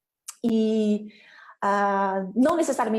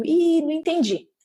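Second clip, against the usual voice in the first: higher, louder acted puzzlement; puzzled dad; 7 semitones higher, 5 dB louder than usual. Dad scratching his head over what was said, puzzled. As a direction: play up not understanding. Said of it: "coçando a cabeça falando".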